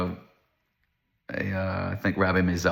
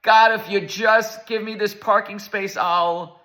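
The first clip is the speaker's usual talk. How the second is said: pleading prayer, much higher and slow; desperate plea to God; 11 semitones higher, slower than usual. Retold or imitated. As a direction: beg God for a parking space, pitched high and slow. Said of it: "he screams to Hashem".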